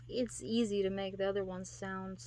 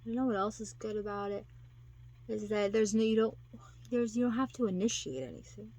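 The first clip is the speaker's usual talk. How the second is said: ordinary talk, nothing marked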